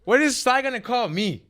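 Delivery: high, loud exclamation; high-pitched defensive outburst; incredulous protest. Real, not acted. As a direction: protest loudly, voice shooting up. Defends himself against host's disbelief.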